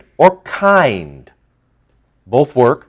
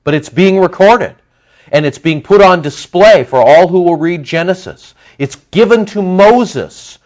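higher, louder preaching build; rising preaching fervour; 6 semitones higher, 4 dB louder than usual. Real, not acted. Preacher tells congregation Scripture is reread, fervent and higher. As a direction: drive it home like a preacher hitting stride.

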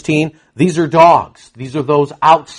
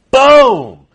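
punchy boom; 5 semitones higher, 7 dB louder than usual. Real, not acted. Preacher exclaims at the climax.

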